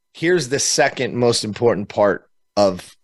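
normal talking voice

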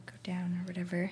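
whisper. Real, not acted. Trails off into a whisper.

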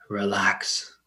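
soft unvoiced whisper. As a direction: breathe the word out. Meditation, whispered single word.

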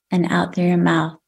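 calm, unhurried breathing guidance with long pauses; guided breathing. Acted. Leads breathing exercise.

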